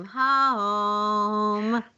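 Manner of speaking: high, drawn-out homecoming call. Acted. Thistle announces arriving to the household, calling out high. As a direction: sing it out through the house.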